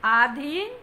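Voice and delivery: "आधीन" with a long opening aa; mispronounced demo. Shown as a demonstration. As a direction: say "आधीन" as AA-dheen. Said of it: "आधीन नहीं होता". (अशुद्ध उच्चारण: "आधीन" में लंबा आ)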